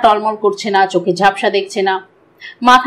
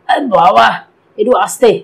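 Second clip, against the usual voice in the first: pained protest at a jolt; groaning in pain. A narrator's reading. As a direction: groan it out as if it hurts. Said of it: "B2 কোকিয়ে উঠে বলল". (ব্যথায় কঁকিয়ে ওঠা)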